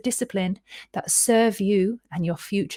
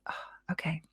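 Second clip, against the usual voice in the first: relaxed whisper; 4 semitones lower, 13 dB quieter than usual. Shown as a demonstration.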